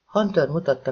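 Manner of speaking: usual speaking voice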